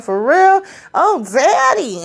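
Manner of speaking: high, slow mock-sweet coo; coy flirt.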